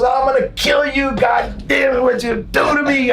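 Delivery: high-pitched outraged screaming rant; imitated screaming. Wrestler to the prankster who jabbed him, screaming. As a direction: imitate a man screaming threats in pain. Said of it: "He starts screaming".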